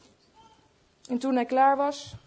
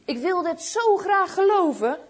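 higher, mildly plaintive longing; sad, to himself; 7 semitones higher than usual. A narrator's reading. Old shoemaker alone after waiting for Jesus, a touch of sorrow. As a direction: lament it to yourself, pitch raised. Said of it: "zei hij treurig in zichzelf".

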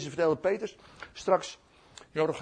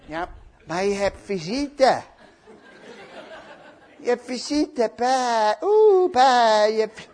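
drawn-out sing-song announcement; sweetly mock-coaxing; friendly coaxing; 5 semitones higher, 7 dB louder, much slower than usual. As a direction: gently hoot and call to a sulking friend. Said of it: "Die gaat heel vriendelijk naar Peet toe".

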